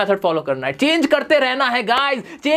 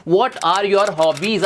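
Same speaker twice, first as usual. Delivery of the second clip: stretched very slowly, each word apart; slow model phrase; much slower than usual. Shown as a demonstration. Teacher modelling a new phrase for students, very slow. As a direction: draw the phrase out for the class. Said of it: "सवाल पूछा जाता है".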